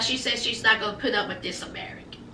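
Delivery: fast, hushed, secretive whisper; gossipy whisper. Real, not acted. Host whispering V's complaint to viewers like juicy gossip. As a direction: lean in and whisper the gossip quickly.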